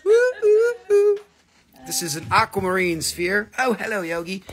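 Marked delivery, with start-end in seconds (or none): 0.0-1.2 shrill party whoops, very high and loud; high whooping woo. Real, not acted. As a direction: whoop like at a concert. Cheering after his song.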